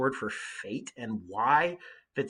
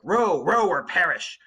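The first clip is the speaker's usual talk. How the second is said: urgent rowing command; 5 semitones higher, 7 dB louder, slower than usual. Acted. Captain urging rowers onward.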